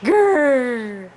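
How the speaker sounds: playful rumbling growl; mock bear growl to a child. Shown as a demonstration. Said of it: "what does a bear say".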